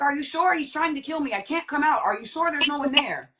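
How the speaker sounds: high, anxious retold plea; fearful pleading. Retold fear of attacker returning.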